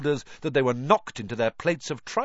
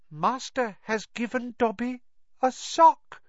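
high-pitched elf full of wonder; awed, high elf voice; elf in wonderment; 10 semitones higher than usual. A narrator's reading. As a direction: marvel in a high little creature voice. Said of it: "said the elf in wonderment".

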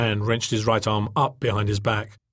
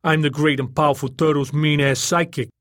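loud, higher, cheery sidekick; cheerful; 4 semitones higher, 5 dB louder than usual. A narrator's reading. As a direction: introduce yourself with cheerful swagger. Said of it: "said rather cheerfully".

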